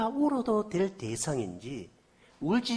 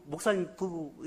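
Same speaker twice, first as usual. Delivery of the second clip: ordinary talk, nothing marked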